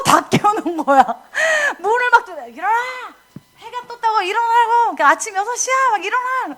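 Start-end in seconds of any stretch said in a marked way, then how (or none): 2.5-6.6 bang on the door and call them up; father's wake-up call. Retold or imitated. Father waking kids at six.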